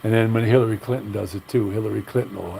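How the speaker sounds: ordinary delivery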